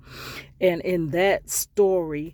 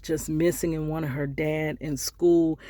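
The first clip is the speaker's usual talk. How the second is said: ordinary talk, nothing marked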